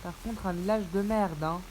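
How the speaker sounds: usual speaking voice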